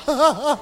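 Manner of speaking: high burst of laughter; hearty laugh. Real, not acted. Telling the congregation about ordering, laughing hard. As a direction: laugh at your own joke.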